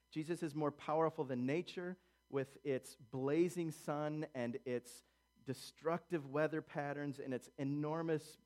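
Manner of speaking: ordinary delivery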